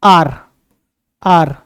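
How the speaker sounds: आर begun straight on the vowel, H dropped; आर with a silent initial H. Shown as a demonstration. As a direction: say आर with no H sound at the start. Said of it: "अब करो ह silent कर दो".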